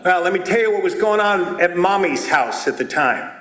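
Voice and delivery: ordinary delivery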